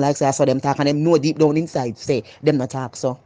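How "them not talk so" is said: usual speaking voice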